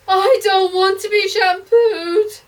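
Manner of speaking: high, loud, slow crying whine; a small boy in the bath wailing. A narrator's reading. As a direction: wail it out through tears. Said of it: "wailed the boy".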